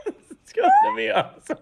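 breaking up in laughter; can't stop giggling; laughing. Real, not acted. Giggling fit during group verse.

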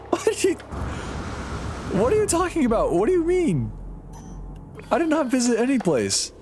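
high-pitched, baffled disbelief; incredulous outburst. Real, not acted. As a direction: squeal it in total bewilderment.

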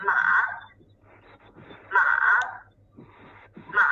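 "ma" falling then rising in pitch; third tone. Shown as a demonstration. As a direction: dip low on each "ma", then come up. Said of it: "a falling rising tone on it".

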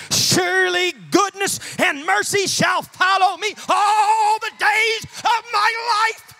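loud, rousing sermon crescendo; full-force preaching. Real, not acted. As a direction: preach up to a soaring, stretched climax. Preaches to a climax.

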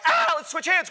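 high, loud yelp; hammed-up frightened shout; panicked rider. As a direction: cry out mid-ride in mock panic. Clingy rider panicking mid-ride.

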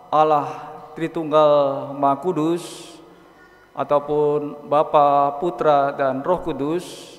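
ordinary talk

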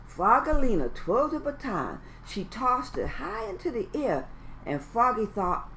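ordinary delivery

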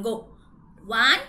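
plain reading voice

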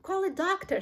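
high-pitched cry for help; urgent shout. A narrator's reading. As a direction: shout out in panic. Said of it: "shouted Mister Beauregard".

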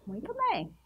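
usual speaking voice

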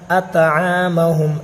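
"اطعامہم" with the ain stretched; long middle vowel in "اطعامہم"; common mispronunciation. Shown as a demonstration. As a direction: say "اطعامہم" as at-aaa-mahum. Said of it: "ایسے پڑھتے ہیں".

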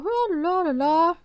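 mocking la-la babble; mock chatter. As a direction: imitate mindless chattering. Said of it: "Die quatschen einfach".

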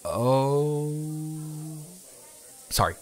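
ordinary delivery

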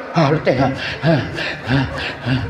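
short puffs of tired breath; mock exhausted huffing; acted panting. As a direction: pant as if worn out from carrying. Acts bearers worn out, panting.

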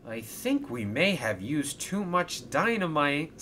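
nervous. A narrator's reading. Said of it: "said Alfie nervously".

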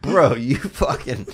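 ordinary delivery

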